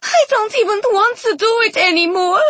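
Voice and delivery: put-on very high girlish whine; high-pitched petulant complaint; whining. A narrator's reading. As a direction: whine like a sulky child. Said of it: "the girl whined".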